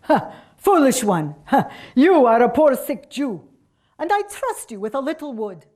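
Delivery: put-on loud scoffing Russian accent; boisterous Russian-accented peasant. A narrator's reading. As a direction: scold her heartily in a Russian accent. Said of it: "in a Russian accent".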